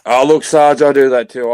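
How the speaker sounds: loud, high call-out across the room; hailing a mate from a distance, full voice. Real, not acted. Drinking mate calls loudly to Sarge across the group, loud.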